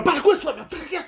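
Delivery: high laughing telling-off; scolding. Retold or imitated. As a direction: shush her, half laughing. Scolding scared friend on plane.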